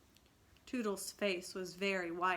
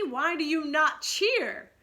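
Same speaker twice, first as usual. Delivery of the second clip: higher, louder, slow indignant question; indignant demand; 8 semitones higher, 11 dB louder than usual. A narrator's reading. Peter scolding the silent boys, hot indignant. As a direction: scold them for not cheering. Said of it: "he said hotly".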